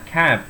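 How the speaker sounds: "cab" with a long English æ instead of a plain A; "cab" with changed A. Shown as a demonstration. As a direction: open the vowel of "cab" towards eh. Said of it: "A-nya dibaca I". ("cab" dengan A panjang dibaca æ)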